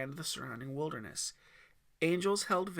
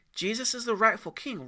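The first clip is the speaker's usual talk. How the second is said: put-on kid voice; 7 semitones higher, 7 dB louder than usual. Aiden argues.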